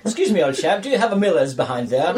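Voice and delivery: laughing mock posh English gent; posh English customer. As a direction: play a prim English toff asking politely. Customer voiced in imagined pub.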